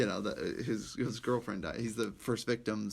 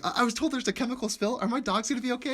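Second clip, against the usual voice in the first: worried lady; 11 semitones higher, faster than usual. Retold or imitated. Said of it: "it's like".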